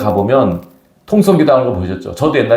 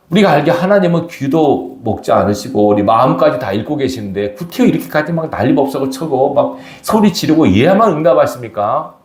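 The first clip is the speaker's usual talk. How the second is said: ordinary talk, nothing marked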